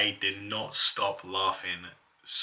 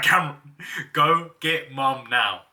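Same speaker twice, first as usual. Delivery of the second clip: urgent order; 6 semitones higher, 9 dB louder than usual. Retold or imitated.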